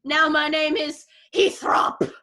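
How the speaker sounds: a hammed-up evil baker ending in a shout; dark lord. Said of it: "They were like".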